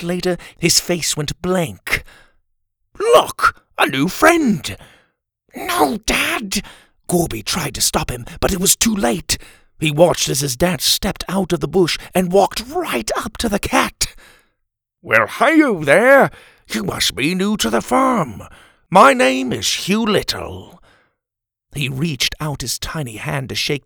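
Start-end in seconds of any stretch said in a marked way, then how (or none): 3.0-4.8 high, loud burst of excitement; delighted dad. A narrator's reading. Father spots the cat, naively delighted. 5.5-6.6 high-pitched cry of warning; alarmed child. A narrator's reading. Child to dad approaching the cat, alarmed. Child tries to stop father. 15.0-20.8 loud, high, hearty greeting; cheery dad. A narrator's reading. Father introduces himself to the cat, hearty.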